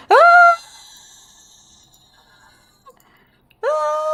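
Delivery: high-pitched mock scream; long, high scream in imitation; imitated scream. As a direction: scream as a substitute for speaking. Imitates Riddler deciding to scream.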